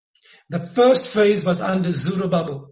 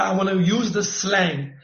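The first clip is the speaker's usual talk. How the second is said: ordinary talk, nothing marked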